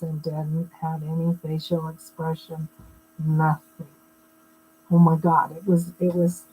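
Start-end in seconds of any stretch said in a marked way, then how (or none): 0.0-3.9 low, droning, expressionless delivery; monotone instructor imitation. Shown as a demonstration. Speaker imitating her boring economics instructor, a flat lifeless drone. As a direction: drone it out like a dull lecturer with zero inflection. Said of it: "he talked like this".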